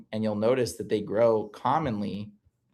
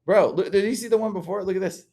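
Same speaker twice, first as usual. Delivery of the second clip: excited; 9 semitones higher than usual. Real, not acted. Excitedly showing elf ear photos.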